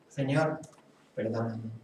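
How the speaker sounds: hushed, humble plea for forgiveness; soft prayer. Acted. Preacher shows how he prays each day, softly. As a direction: barely say it, head bowed.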